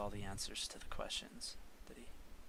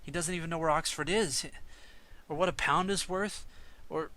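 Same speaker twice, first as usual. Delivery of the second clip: much louder and higher, protesting; rising frustration in every line; father objecting to sending his boy away; upset father; 7 semitones higher, 9 dB louder than usual. Acted.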